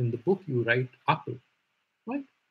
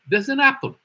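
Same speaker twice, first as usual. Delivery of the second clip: high, loud, proud announcement; a toddler showing off his new word; proud child; 12 semitones higher, 8 dB louder than usual. Retold or imitated. Little boy telling mama, proud and bursting. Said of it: "very proud that today he is going".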